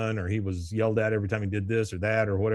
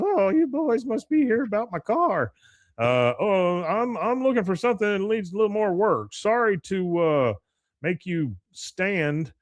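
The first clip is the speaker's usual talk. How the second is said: high-pitched imitation of an old lady; mock old lady; 9 semitones higher than usual. Old lady greeting boys about her car, high imitated voice. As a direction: put on a high old-woman voice. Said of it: "this old lady starts coming out".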